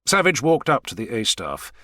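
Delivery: ordinary delivery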